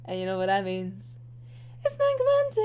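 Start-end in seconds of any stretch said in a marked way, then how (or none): 1.8-2.6 high, bright title announcement; show title call. Real, not acted. Host announcing her manga show, high and excited. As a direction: sell the show name up high.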